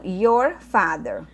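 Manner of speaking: "Your father" said very slowly, each word leaned on; stressed for attention. Shown as a demonstration. Teacher asking students to pay attention to "Your father", said very slowly. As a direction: draw it out so they notice "Your". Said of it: "presta atenção".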